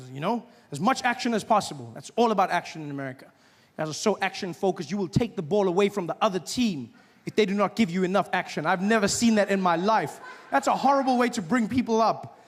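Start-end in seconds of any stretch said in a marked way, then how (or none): none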